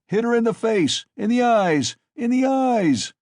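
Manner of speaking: high, loud shouting cry; drunken man urging on the beating, loud and high. A narrator's reading. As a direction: scream it at the crowd. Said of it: "cried Mikolka".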